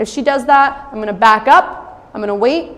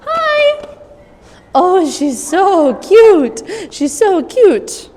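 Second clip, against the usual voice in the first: high cooing; 7 semitones higher, 3 dB louder, much slower than usual. Real, not acted. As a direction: coo slowly at the pup. Cooing at puppy.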